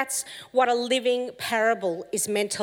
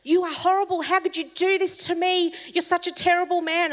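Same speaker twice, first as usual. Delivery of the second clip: king berating the rabbi, voice raised high in fury; furious king; 10 semitones higher than usual. A narrator's reading. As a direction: rage at the rabbi who shot his finger. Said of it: "The king becomes furious".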